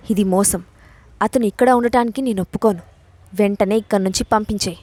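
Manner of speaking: ordinary delivery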